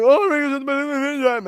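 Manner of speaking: very high, rushed mocking voice; high-pitched babbling imitation; mocking fan quote. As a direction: parody the fans in a loud squeal. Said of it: "They're like".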